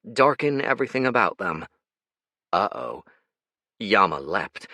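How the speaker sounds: ordinary delivery, nothing marked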